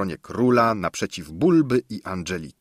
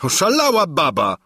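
loud, scornful aside; dismissive young king; 8 semitones higher, 5 dB louder than usual. A narrator's reading. King shrugs her off to the guests, loud and scornful. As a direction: brush her off as mad.

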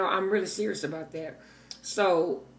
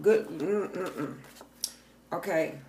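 usual speaking voice

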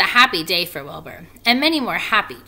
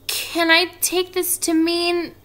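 high, slow, careful question; Wilbur's hopeful voice; 10 semitones higher, 4 dB louder, slower than usual. A narrator's reading. Wilbur questions the little spiders, high and hopeful. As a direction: ask with rising hope, pitched up. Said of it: "asked Wilbur".

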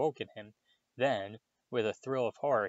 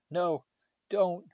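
high, louder plea; weak protest; 7 semitones higher, 7 dB louder than usual. A narrator's reading. Harry begging Lockhart to stop, higher pleading. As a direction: plead to be left alone, voice raised. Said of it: "said Harry weakly".

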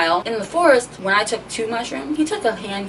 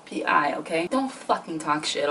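normal talking voice

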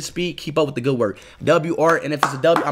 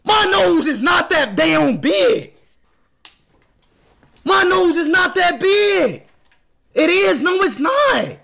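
higher, louder indignant yell; outraged shout; 8 semitones higher, 4 dB louder, slower than usual. Real, not acted. Speed yelling at fan art of his nose, outraged. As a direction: protest at the top of your voice.

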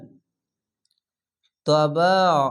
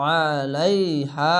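very slow drawn-out Quranic chant; tajweed recitation demo; much slower than usual. Shown as a demonstration. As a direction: stretch every syllable of the word. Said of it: "স্লোভাবে একটু পড়ছি কেন".

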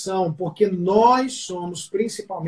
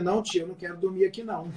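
timid refusal; 8 dB quieter, faster than usual. Retold or imitated. Child refusing sleepover, reading mother's look.

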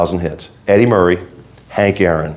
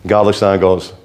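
ordinary talk

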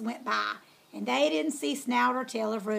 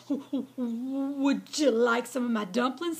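stammering scared; slower than usual. A narrator's reading. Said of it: "stammered Oinky".

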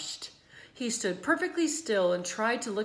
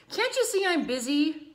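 disgusted; 8 semitones higher, 6 dB louder than usual. A narrator's reading. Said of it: "replied Avery in disgust".

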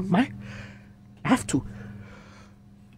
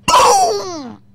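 loud, high boom imitating the impact; crash sound; 9 dB louder than usual. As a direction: shout the crash like an explosion. Acting out the car crash.

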